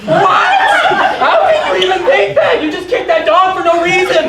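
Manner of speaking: loud, high outraged disbelief. Acted. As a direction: shout in shocked disbelief. Outraged at dog kicking.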